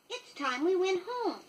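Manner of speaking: a lightly voiced girl urging home; Wendy's wish. A narrator's reading. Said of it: "Wendy said".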